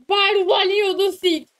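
loud shout. Real, not acted. Excited shout at game monster.

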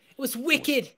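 put-on wicked in a high Boston accent; loud Boston guy saying wicked. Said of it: "Boston boy".

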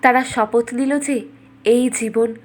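plain reading voice

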